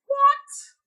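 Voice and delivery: sudden very high, loud "What?"; startled what. Retold or imitated. Hunchback woman shrieks at a strange question. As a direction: yelp it in shock, way up high.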